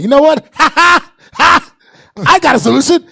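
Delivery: high, loud manic mom with cackling laughs; a mom who just snapped, laughing wildly; snapping mom. Retold or imitated. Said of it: "another version he do where she snaps".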